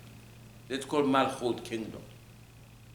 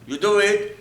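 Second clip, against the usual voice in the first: emphatic; 7 semitones higher, 7 dB louder than usual. Real, not acted. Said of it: "preaching emphasis".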